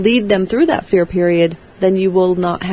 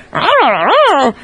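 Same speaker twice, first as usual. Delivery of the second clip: ticked-off wordless wah-wah babble; garbled teacher scolding, higher, swooping up and down; Charlie Brown's teacher; 7 semitones higher, 4 dB louder, faster than usual. Acted. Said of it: "when she was ticked off".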